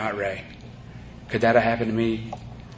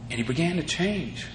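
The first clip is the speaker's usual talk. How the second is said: usual speaking voice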